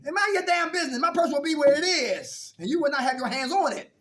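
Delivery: Ma guarding her purse, firm; mother warning her son off her purse, loud; stern mother. Retold or imitated. Voicing mother scolding.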